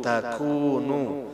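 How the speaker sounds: tasumu and takunu stretched slowly with a heavy su; slow Quranic syllable drill; slow drawn-out syllables. Shown as a demonstration. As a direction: chant each syllable slowly. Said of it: "tajwid reading demo".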